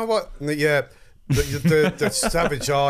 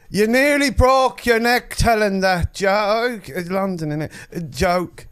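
put-on London accent; 5 semitones higher, 3 dB louder than usual. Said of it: "London accent".

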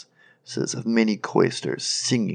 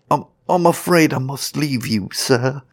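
high, louder stammered excuse; frightened sexton; 6 semitones higher, 5 dB louder than usual. Acted. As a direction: nervously try to slip away. Frightened sexton tries to escape.

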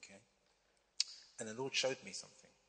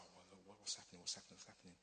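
much softer, muttered under the breath; frustrated muttering; 2 semitones lower, 13 dB quieter, faster than usual. Retold or imitated. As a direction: grumble it to yourself, nearly inaudible. Frustrated at the empty barber shop.

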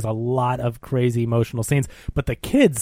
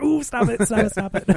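mock alarm; 8 semitones higher, 3 dB quieter than usual. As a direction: plead in a high, playful fluster. Acts own alarm at loud yelling.

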